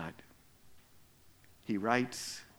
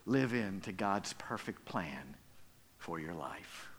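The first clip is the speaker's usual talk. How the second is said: soft sermon close; 5 semitones lower, 7 dB quieter, slower than usual. Acted.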